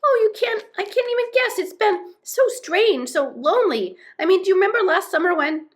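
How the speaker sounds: high, breakneck gush cut short; agitated high-pitched babble; overwrought outpouring. A narrator's reading. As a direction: pour out your troubles to a long-missed friend. Overwrought Moomin Troll pours out troubles.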